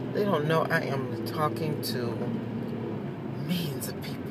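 whispered joke; playful whispered aside. Real, not acted. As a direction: drop to a whisper as if sharing a secret. Joking secret to viewers.

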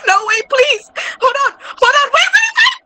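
high, frantic begging; panicked pleading. Real, not acted. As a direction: beg in rising panic. Panicked pleading while being chased.

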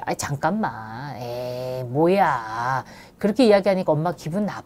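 put-on soft, slow, easygoing telling-off to a child; calm, relaxed. Shown as a demonstration. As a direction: gently let the child know it stung, no fight. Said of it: "편안하게".

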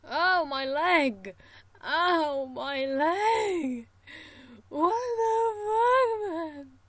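loud anguished cries; pained yelling. Real, not acted. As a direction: cry out in pain. Character loses leg, yelling.

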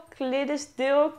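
"Clydesdale" slowly as KLIH-des-dil; unsure pronunciation. Shown as a demonstration. Vlogger trying another guess at the breed, "Clydesdale" as KLIH-des-dil. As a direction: try "Clydesdale" with a short i in the first syllable, KLIH-des-dil. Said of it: "geen idee hoe je het uitspreekt".

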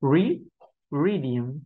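"Re, radium" with a rounded English R; rounded-lip R. Shown as a demonstration. Teacher showing learners the R sound, lips rounded. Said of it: "phụ âm R chúng ta cần phải tròn môi".

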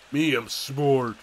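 slow, dopey brag; dumb caveman voice. Pretend. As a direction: drag out each word like a caveman. Gloating after solving puzzle.

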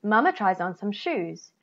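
ordinary delivery, nothing marked

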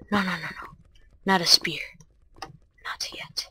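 soft unvoiced whisper. Real, not acted. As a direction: whisper it to yourself. Whispered to self.

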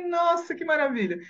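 high-pitched retold wonder; moved amazement. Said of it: "eu fiquei tão emocionada".

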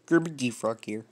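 attempted puppet frog voice; amateur cartoon frog greeting; Kermit the Frog. Shown as a demonstration. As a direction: do a not-quite-there frog puppet impression. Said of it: "I'm gonna do is Kermit the Frog".

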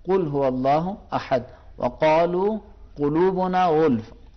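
slow "قل" and "وقالوا" with a deep back ق; deep qaf recitation. Shown as a demonstration. Teacher reciting qaf examples for the student. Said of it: "اور قاف جو ہے".

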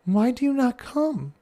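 a touch of sad, pleading question; mock-sad plea to the child; sadly sighing. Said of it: "I sadly sigh".

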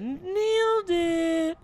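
high, slow mocking triumph; sarcastic cheer. Real, not acted. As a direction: drag it out in mock victory.

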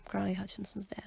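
whispered softly, breaking sad news; hushed bad news. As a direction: breathe it out like a grim secret. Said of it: "she was like".

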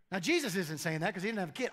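usual speaking voice

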